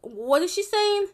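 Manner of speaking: mock their confusion, drawn out and high; a bully imitating her accent with exaggerated confusion; mocking classmates. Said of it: "People would just be like".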